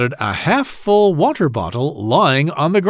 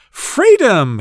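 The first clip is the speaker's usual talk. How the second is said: high, louder cry of joy; shouting; 8 semitones higher, 4 dB louder than usual. Acted. Freed ant tumbles onto the grass, high joyful shout. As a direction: burst out with relief. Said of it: "he shouted".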